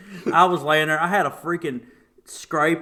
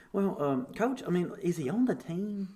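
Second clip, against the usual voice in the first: polite mom imitation; 5 semitones higher, 4 dB quieter than usual. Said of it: "but she's just like".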